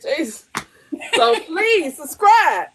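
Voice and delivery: ordinary talk, nothing marked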